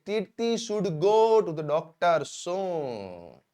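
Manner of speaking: very slow, word by word, for learners; slow dictation. Shown as a demonstration. Teacher to students, slow clear dictation. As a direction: spell it out slowly so students can write. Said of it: "teacher dictates English model sentence".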